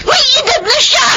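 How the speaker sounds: put-on older sister scolding her brother over their mother; crying shout. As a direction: scream at him through tears. Sister confronts brother about mother.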